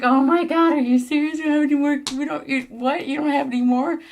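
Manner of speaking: half-sobbing "are you serious?"; crying in disbelief. Retold or imitated. Stranded traveler, sobbing and yelling at the agent. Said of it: "I just started crying".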